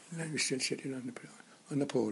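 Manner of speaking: whispered, barely voiced; hushed retelling. Old man whispers his brother's war memory to visitors. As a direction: drop to a whisper as if still afraid to be heard.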